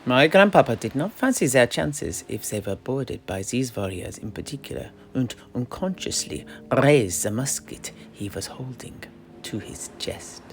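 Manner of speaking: ordinary talk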